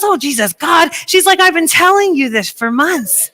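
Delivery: high, loud exasperated friend. Retold or imitated. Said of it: "she goes".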